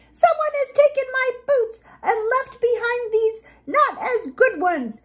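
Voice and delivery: very high, squeaky cub wail; wailing baby bear. A narrator's reading. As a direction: wail like a small upset bear. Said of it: "she wailed".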